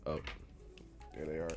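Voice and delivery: ordinary talk, nothing marked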